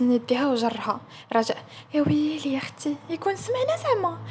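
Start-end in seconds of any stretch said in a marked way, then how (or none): 1.9-4.2 high-pitched anxious fretting; worried. Acted. Raja fearing the boss overheard their gossip, worried. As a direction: fret in a high, alarmed voice.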